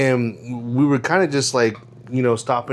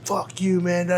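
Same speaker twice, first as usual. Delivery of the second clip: higher, held-back mock outburst; mild mock cursing, pitched up; retold freak-out; 7 semitones higher, 4 dB quieter than usual. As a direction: imitate a stranger cussing, without the volume. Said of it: "freaked out on him".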